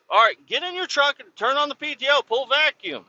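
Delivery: high, fast retold instructions; exasperated orders. Driver telling the kid to pull vacuum, retold exasperation. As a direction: reel off urgent orders, pitched well up.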